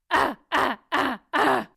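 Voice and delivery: loud gritty ah, ah from the belly; grunted ah. Shown as a demonstration. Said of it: "now we're going to do the ah".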